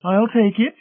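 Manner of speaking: high-pitched, vacant matron voice; obliging matron. A narrator's reading. Mrs Pritchett reaching for the pitcher, high and vacant. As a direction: offer in a high character voice.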